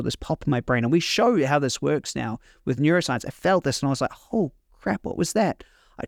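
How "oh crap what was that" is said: higher, a sudden startled gasp of words; startled surprise. Retold or imitated. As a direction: caught off guard by something strange. Sudden pop in brain, tears.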